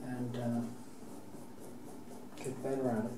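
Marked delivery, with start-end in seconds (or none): none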